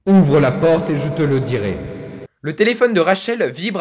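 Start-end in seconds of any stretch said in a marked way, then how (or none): 0.1-1.8 the mother begging at the door, low and eerie; possessed mother's deep voice. Acted. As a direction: plead through the locked door in a lower, darker voice. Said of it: "la mère a une voix bien grave".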